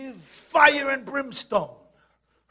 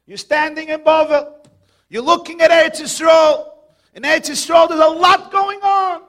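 loud, higher, driving preacher's cry; preaching shout; 4 semitones higher, 9 dB louder than usual. Real, not acted. Preacher shouting the picture at his congregation. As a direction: hammer it at the congregation.

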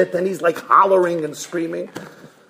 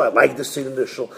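low, quick reply; rabbi's dismissive answer; 4 semitones lower, 3 dB quieter, faster than usual. Acted. Rabbi answers the board, quick low reply. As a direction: drop low and brush it off. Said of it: "He says".

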